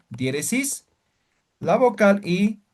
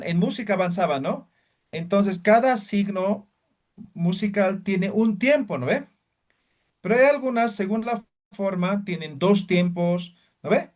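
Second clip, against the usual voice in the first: ordinary talk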